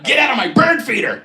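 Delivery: high, loud yelling at a squirrel; hollering at the bird feeder thief; mock yell at squirrel. Old neighbor yelling at a squirrel from his window.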